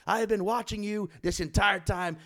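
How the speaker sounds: high firm public threat; mock stern frontman callout; stern warning. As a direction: warn him in front of everyone. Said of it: "He's like".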